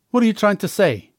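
defensive angry outburst at the cellmate; angry yell. A narrator's reading. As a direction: snap back, stung by the insult. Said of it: "I yell angrily".

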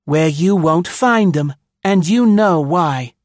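loud, angry retort in a put-on woman's voice; low angry woman. A narrator's reading. Woman answers the colonel through the door, angrily. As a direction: snap it back in anger. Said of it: "A woman's voice, low, angry".

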